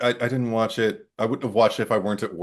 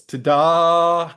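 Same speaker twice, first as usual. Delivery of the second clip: mock fanfare; 7 semitones higher, 6 dB louder than usual. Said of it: "I just put in quotes".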